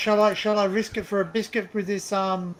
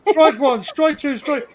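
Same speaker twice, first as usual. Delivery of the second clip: mock strike call; 8 semitones higher, 6 dB louder, much slower than usual. Mock umpire calling channel strikes.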